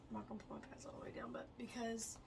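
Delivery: fast breathy whisper. Real, not acted. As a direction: rush it in a whisper. Whispering a quick aside.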